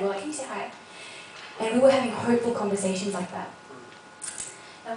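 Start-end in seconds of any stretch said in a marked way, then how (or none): 0.2-0.7 softer, gentler echo of the question; soft repeat. Retold or imitated. Friends repeat their request to the mum, softly. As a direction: say it again quietly, almost to yourself. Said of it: "we were actually really excited".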